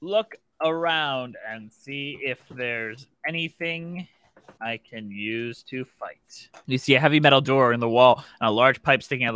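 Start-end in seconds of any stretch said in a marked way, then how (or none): 0.0-6.5 slow, low typing-along read; slow dictation. Shown as a demonstration. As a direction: say it slowly as you type it. GM types it back slowly.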